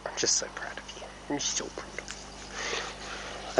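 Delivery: soft, slow tender whisper; whispered praise. Real, not acted. Owner whispering praise to her horse after training, tender whisper. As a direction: breathe your pride into its ear.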